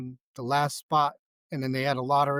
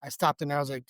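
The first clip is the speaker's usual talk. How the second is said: normal talking voice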